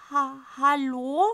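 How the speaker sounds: high, loud stammered hello; nervous call. Acted. Boy calls timidly into the toilet bowl, loud and stammering. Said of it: "ruft er in die Klomuschel hinein".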